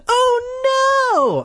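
high, loud wail of alarm; horrified cry. A narrator's reading. Friend seeing Mike bitten, crying out. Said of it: "I cried".

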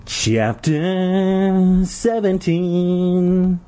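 very high, very slow creepy sing-song; Jelly Boy creepily announcing the chapter number. Pretend. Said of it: "bringing on my friend the Jelly Boy".

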